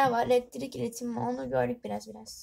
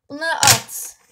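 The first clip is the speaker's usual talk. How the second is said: loud decisive order; 3 semitones higher, 12 dB louder, much slower than usual. Real, not acted. Girl clearing out pens, loud decisive command. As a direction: order it firmly to yourself. Throwing away bad pens.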